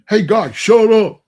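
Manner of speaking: mock the big kid yelling shut up; mock bully shout. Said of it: "He looks at me and say".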